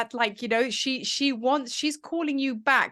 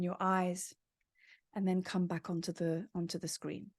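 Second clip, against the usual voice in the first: guided meditation; 5 semitones lower, 5 dB quieter than usual. Acted. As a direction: lull them gently into the vision.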